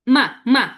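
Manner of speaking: short, loud "মা" falling steeply from high; fourth tone. Shown as a demonstration. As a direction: punch "মা" downward. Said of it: "fourth tone হবে". ("মা" চতুর্থ টোনে, জোরে নিচে নামিয়ে)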